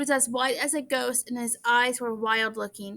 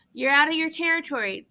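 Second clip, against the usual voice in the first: ordinary delivery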